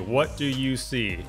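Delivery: ordinary talk, nothing marked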